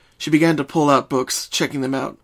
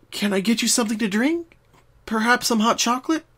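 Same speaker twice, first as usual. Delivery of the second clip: put-on higher, bright young mare voice; Twilight voice; 10 semitones higher than usual. Twilight opens the door to Pinkie, higher friendly voice. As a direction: voice Twilight greeting her friend.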